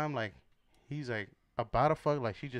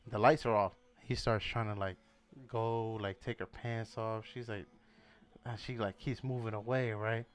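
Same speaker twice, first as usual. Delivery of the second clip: normal talking voice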